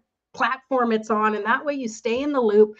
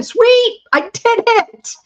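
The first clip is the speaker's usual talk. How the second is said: triumphant; 12 semitones higher, 9 dB louder than usual. Real, not acted. Finished counting, triumphant.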